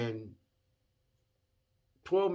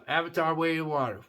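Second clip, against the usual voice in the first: normal talking voice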